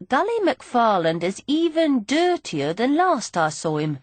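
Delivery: ordinary delivery, nothing marked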